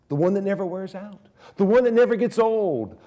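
building proclamation. Acted. Preacher building praise of the eternal God. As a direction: build the line to a ringing peak.

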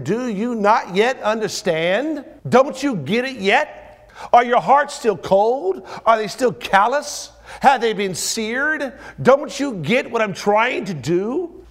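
high, loud, drawn out; impassioned slow preaching; slow pleading rebuke. Acted. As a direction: plead with them to finally see.

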